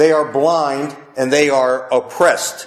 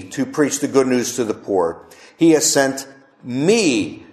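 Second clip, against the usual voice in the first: "me" isolated and stretched at the end; stress on "me"; 3 semitones lower than usual. Shown as a demonstration. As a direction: pause before a long, weighted "me". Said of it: "the emphasis on the word me".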